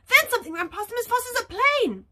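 fast narration. A narrator's reading. Fast story pace.

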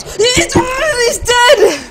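high, loud victory shout; real elated yell; triumphant shout. Real, not acted. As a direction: scream it in triumph. Killing the crab boss.

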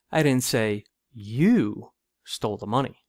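pause, then punch the word "you"; deliberate, heavy emphasis on "you"; stress on YOU. Shown as a demonstration. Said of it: "with emphasis on each word".

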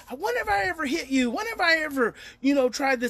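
put-on indignant teen. Teen protests at mom.

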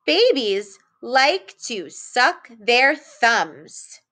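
put-on slow, careful read-aloud for young kids. Shown as a demonstration. Teacher reads a sentence aloud slowly for children. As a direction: read each word slowly and clearly. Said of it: "hear me read it again".

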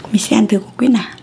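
normal talking voice

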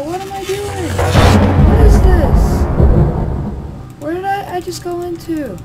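high, loud, bewildered questions; panicked confusion. Real, not acted. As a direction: cry out lost and alarmed. Sudden loud game noise.

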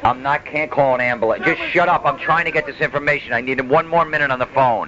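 high, annoyed snapping; irritated snapping. Pretend. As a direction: snap at him impatiently. Snapping at grandpa mid-call.

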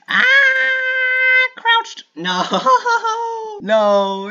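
very high squealing laugh; real high laughter; shrieking laugh. Real, not acted.

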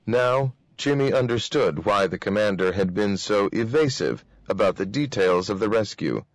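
usual speaking voice

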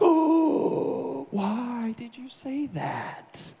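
high, drawn-out hammed-up hurt; mock wounded. Boy called a name by his friend, playing hurt. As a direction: act wounded, stretching the words out high.

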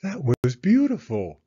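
mother praising warmly; lightly voiced mother. Mama praises Penny's song, lightly voiced. Mama's voice.

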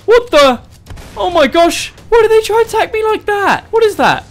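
high panicked shrieks. Real, not acted. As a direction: yelp as the monsters swarm in. Attacked by fish skeleton creatures.

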